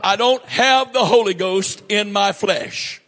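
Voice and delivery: ordinary delivery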